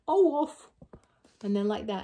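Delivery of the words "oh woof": higher, louder dopey woof; a silly mixed-up dog noise; dog skeleton. Acted. Mixed-up dog skeleton barks, higher and louder. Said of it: "and he went".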